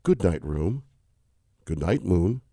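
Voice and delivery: lulling storybook reading, unhurried; gentle lull of a bedtime read-aloud; bedtime story read. Acted. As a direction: read it to sleepy kids at bedtime. [name] reads Good Night Moon aloud.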